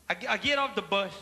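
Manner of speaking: usual speaking voice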